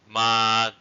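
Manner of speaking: "Mud" held long and loud on a level tone; long vowel. Shown as a demonstration. Teacher giving a long-vowel example, drawn-out "Mud". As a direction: stretch the vowel of "Mud", don't release the end. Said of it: "examples with long vowels".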